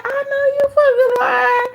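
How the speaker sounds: high, loud call-out of the lie; high accusing disbelief; incredulous. Retold or imitated. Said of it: "I said".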